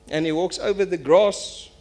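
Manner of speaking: ordinary talk, nothing marked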